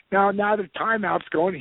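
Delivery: usual speaking voice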